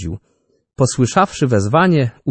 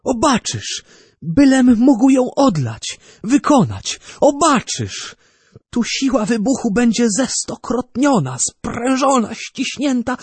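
much higher, feverish visionary rant; an inventor swept away by his weapon; obsessed inventor; 14 semitones higher than usual. A narrator's reading. Narrator voices feverish Orbano.

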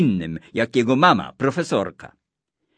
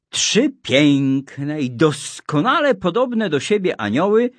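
usual speaking voice

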